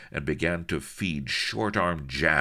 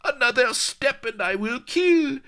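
very high, loud shrieking threat; high piercing cry of a cornered lady; shrill shriek; 7 dB louder than usual. Acted. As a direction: shriek it at him in a panic. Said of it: "she shrilled again".

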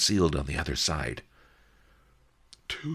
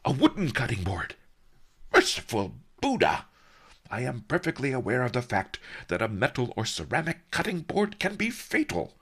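higher, fast, indignant snarl; mock snarl; 7 semitones higher, faster than usual. Old sage snarling at the merchant, higher and fast. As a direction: bristle at the insult, rattling it off. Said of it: "Master Li snarled".

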